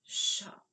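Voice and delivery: "Shop" with a long, strong sh; drawn-out first sound. Shown as a demonstration. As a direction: push out a long sh before the rest of "Shop". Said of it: "We cut off the sh".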